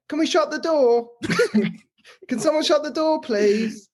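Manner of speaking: high, strained plea; pleading high voice. Retold or imitated. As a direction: beg feebly for the door. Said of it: "Like that, right?".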